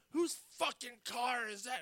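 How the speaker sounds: high, slow mock angry yell; angry boss. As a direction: play the furious boss demanding whose car it is. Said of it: "He's like".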